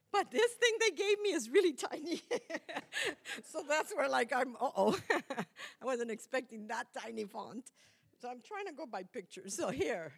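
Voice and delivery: real giggles, high and loud; laughing at herself. Real, not acted. Preacher caught out by tiny notes, laughing at herself. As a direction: laugh through the embarrassment.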